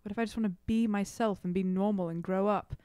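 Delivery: ordinary delivery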